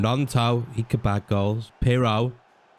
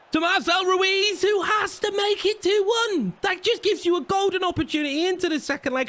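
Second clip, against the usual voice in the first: high, thrilled build-up, much higher pitch; excited high call. Real, not acted. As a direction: yell it as the goal looks certain. Big chance for his team.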